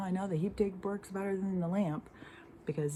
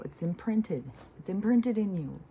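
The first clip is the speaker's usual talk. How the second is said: climbing louder and higher on the repeat; building insistence; 5 semitones higher, 5 dB louder than usual. Real, not acted. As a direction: hammer it home harder the second time. Insistent rant, repeated for force.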